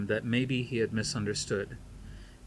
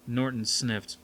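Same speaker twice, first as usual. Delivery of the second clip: ordinary delivery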